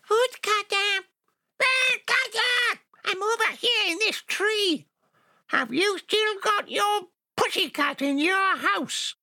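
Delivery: very high little creature calling out; eerie ghost child. Acted. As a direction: call like a tiny voice from a tree. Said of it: "a little voice called to him".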